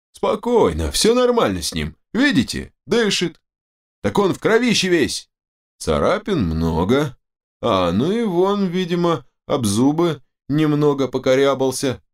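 high-pitched medic's character voice; doc, unruffled. Acted. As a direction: report on the patient in a high, reassuring voice. Doc examines the wounded man. (высокий голос дока, успокаивает)

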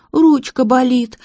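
put-on high, slow little-girl whine; childish whimper. A narrator's reading. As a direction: whine like a child with a sore arm. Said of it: "по-детски захныкала девушка".

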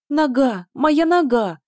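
howling high and loud; inhuman howl. A narrator's reading. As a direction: wail it out in pain. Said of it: "взвыла принцесса нечеловеческим голосом".

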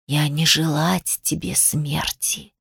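slow, soft, low serpent voice; hissing snake. A narrator's reading. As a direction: hiss it slowly, low and hushed. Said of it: "прошипела змея". (тихое, медленное змеиное шипение)

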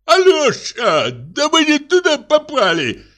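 high, slow tearful whine; a touch of a crying drunk; whining. Acted. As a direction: wail it like a lost drunk. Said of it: "плачущим голосом".

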